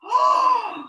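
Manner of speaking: loud, high gasp of wonder; big theatrical awestruck gasp; amazed gasp. Acted. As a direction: gasp as if astounded by a great singer. Donkey amazed by cat's meow.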